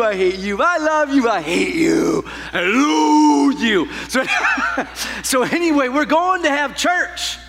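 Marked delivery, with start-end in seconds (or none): 0.0-0.6 dropped-pitch aside under a greeting; comic undercutting aside; mock hidden hatred. 0.6-1.3 high, loud fake-loving greeting; sugary mock affection, pitched up; churchy sweetness. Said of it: "We put on that churchy face". 1.3-2.2 low, comic growing-resentment aside; put-on loathing, pitched down; mock hidden hatred. Hidden hatred under churchy greeting. 2.5-3.9 long, drawn-out high shout; comic slow-motion yell; hammed-up outburst. Climax of acted fake greeting.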